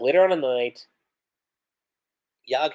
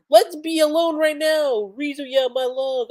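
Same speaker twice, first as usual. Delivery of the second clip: much higher, slow, put-on romantic voice; flirty husband; 12 semitones higher, 3 dB louder than usual. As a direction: ham up the smitten lover. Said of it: "Yazuma says".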